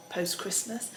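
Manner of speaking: usual speaking voice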